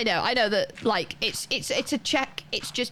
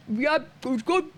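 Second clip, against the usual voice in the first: mock mouthful; 4 semitones higher than usual. Answering waiter with mouth full.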